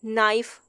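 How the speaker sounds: नाइफ with a silent k. Shown as a demonstration. Teacher repeats नाइफ for the class, k silent. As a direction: say नाइफ starting on n. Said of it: "के silent है".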